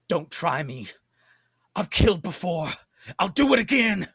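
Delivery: threat building to a high, loud cry; desperate, fierce warning that rises; menacing threat. Acted. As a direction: warn them off, escalating with each line. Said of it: "she bares her teeth at you".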